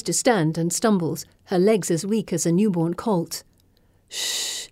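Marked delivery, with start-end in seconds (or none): 4.1-4.7 whispered hush; soft shush. Acted. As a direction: hush her gently. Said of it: "Felicity says softly".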